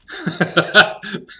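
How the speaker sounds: high cackling laugh; sudden high laugh at a card name; burst of laughter. Real, not acted. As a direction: laugh out at the joke. Laughing at a stupid joke.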